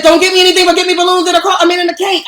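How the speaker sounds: loud, high-pitched insistence; heated protest. Real, not acted. Defending the birthday request to partner, heated protest. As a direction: raise your voice and insist on it.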